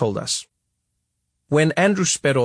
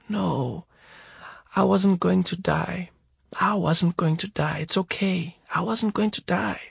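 soft and higher, gently calming; calm him down softly; reassuring mother; 6 semitones higher, 3 dB quieter than usual. A narrator's reading. Mother in hospital bed reassuring her son, soft.

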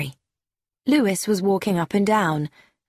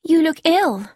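put-on worried young woman; 9 semitones higher, 4 dB louder than usual. A narrator's reading. Gina worried about Louise.

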